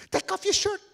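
high, loud wailing order; frantic kid's cry; crying panic. Acted. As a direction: plead through acted tears. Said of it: "Keoki's crying".

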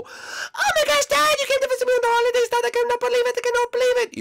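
put-on very high, fast, overjoyed kid voice; tiny high voice bursting with joy at seeing dad; squeaky excited Lemmy. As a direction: squeal it out like an overexcited little cousin. Lemmy's voice, cousin greets dad.

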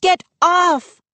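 very high, urgent shout; shrieked order. A narrator's reading. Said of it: "Mindy shrieked".

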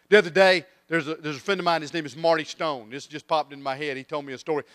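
ordinary talk

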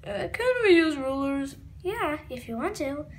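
plain reading voice

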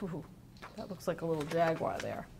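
usual speaking voice

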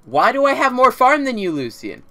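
loud high outburst; angry yelling. Real, not acted. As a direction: yell it in disbelief. Yelling at teammate Lucian.

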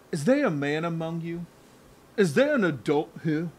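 put-on a lightly done Louisiana accent, doing Malkovich; John Malkovich's Louisiana accent. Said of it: "He's trying to do a Louisiana accent".

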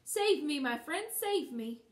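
loud, drawn-out cry for help; urgent, stretched-out appeal; pleading. A narrator's reading. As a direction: plead to be rescued. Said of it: "she pleaded".

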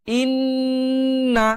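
জান্না with the ন্ন hummed long through the nose; held nasal ghunnah. Shown as a demonstration. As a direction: stretch the nasal hum in জান্না. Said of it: "গুনগুন আওয়াজ".

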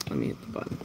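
ordinary talk

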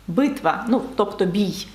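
usual speaking voice

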